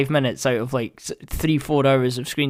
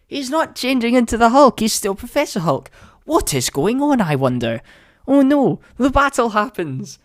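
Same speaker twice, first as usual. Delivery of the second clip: hammed-up dismay, pitched way up; mock panic; 9 semitones higher than usual. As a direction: wail it like a panicking fan. Acting out panic while watching.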